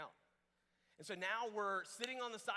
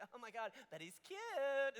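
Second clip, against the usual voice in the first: higher, drawn-out imitation of swooning; mock smitten girl; 5 semitones higher than usual. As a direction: gush like someone with a silly crush. Imitated smitten girl ignoring warnings.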